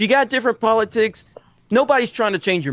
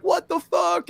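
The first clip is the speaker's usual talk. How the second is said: high startled what the fuck; freaked-out quote; 12 semitones higher, slower than usual. Retold or imitated. Fans quoting their shock, high startled yelp. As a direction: squeak it out like shocked guests.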